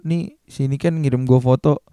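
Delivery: ordinary talk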